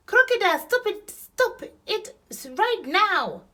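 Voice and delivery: high, loud outraged command; shouting Hippo. A narrator's reading. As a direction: order an attacker off at full voice. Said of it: "shouted Hippo".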